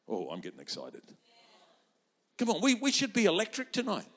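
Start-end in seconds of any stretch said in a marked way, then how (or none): none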